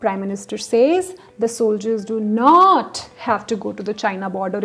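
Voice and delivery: "not" stretched and punched; heavy falling emphasis on "not"; stress on not. Shown as a demonstration. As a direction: land firmly on "not", then hurry through the rest. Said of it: "changing the emphasis on different words".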